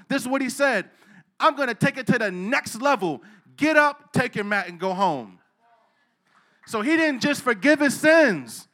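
high, loud, impassioned pulpit voice; preaching at full force. Acted. Pastor proclaims Jesus' authority to the church, full force preaching. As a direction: drive it home to the congregation.

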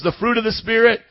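ordinary talk

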